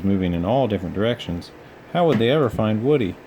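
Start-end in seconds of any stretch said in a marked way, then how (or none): none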